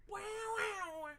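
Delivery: very high, loud squealing wows; squealed wow. Acted. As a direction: shriek it like a cartoon. Squealed reaction.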